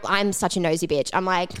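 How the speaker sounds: normal talking voice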